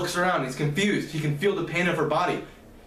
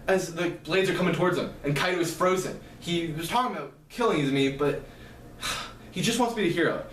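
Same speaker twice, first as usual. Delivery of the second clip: usual speaking voice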